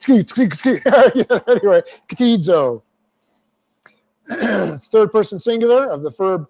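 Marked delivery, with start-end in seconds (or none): 0.1-1.8 drawn-out chuckle into "Anyway"; amused at the odd Greek word; laughing. Real, not acted. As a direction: laugh it off slowly.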